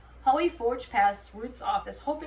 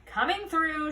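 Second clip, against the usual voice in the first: gruff, raised police call; grunted; 6 semitones higher, 4 dB louder, slower than usual. A narrator's reading. Holly barking at the protesters to clear a path. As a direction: push through the crowd with a bark.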